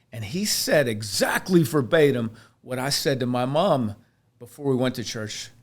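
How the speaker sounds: ordinary delivery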